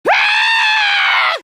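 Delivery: long, very high scream. Acted. Screaming in the midst of danger, high scream.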